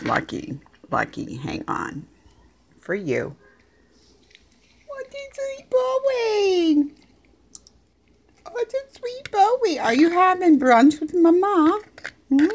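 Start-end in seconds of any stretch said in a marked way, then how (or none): 4.9-12.5 very high, squeaky pet-owner coo. Real, not acted. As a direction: coo sky-high to a beloved dog. Baby-talking to her dog.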